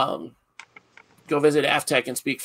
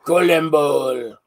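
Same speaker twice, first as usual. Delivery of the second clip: Colon bowl, slow and louder in a French accent; 7 dB louder, much slower than usual. Shown as a demonstration. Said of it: "Should sound just like the name in French".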